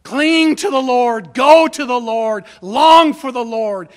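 loud, slow hammering of "Cling", "go", "long"; forceful preached stress on "Cling", "go" and "long"; emphatic. Shown as a demonstration. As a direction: punch each opening verb, "Cling", "go", "long", and stretch it. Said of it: "It's emphasizing it".

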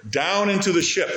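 Down hit high and hard; preacher leaning on Down; stress on down. Real, not acted. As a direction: lift the word Down well up and land the line. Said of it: "That word down is repeated a lot".

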